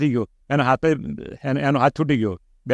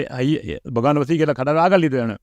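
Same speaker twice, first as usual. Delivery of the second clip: normal talking voice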